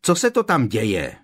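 loud shouted question; calling across. A narrator's reading. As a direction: demand news from out of sight. Ron shouts through the wall.

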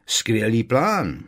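slow, loud mock praise; sarcastically impressed; ironic admiration. A narrator's reading. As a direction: praise it with drawn-out irony. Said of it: "přitakal s ironickým obdivem Harry".

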